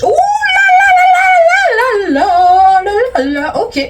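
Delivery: high, loud, slow drawn-out squeal; mock shocked squeal. Real, not acted. Reacting to the story getting steamy, high drawn-out squeal. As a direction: stretch out the oh la la in shock.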